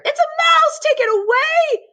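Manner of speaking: very high, loud panicked shriek. A narrator's reading. Mrs Jenkins seeing the mouse on the table, shrieking in terror. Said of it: "shrieked and cried and screamed".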